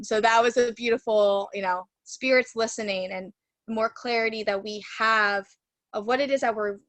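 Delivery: normal talking voice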